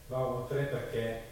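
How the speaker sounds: ordinary talk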